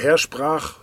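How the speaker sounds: normal talking voice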